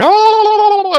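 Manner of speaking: very high, drawn-out cry of alarm; hammed-up panicky squeal; mock frightened wail. Acting her fright at the gunshot.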